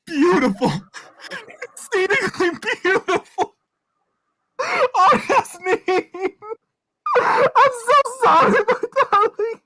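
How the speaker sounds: laughing through tears, broken; sobbing grief. Acted. Son crying over Nazneen's body, broken sobs.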